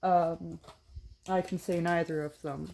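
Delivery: ordinary talk, nothing marked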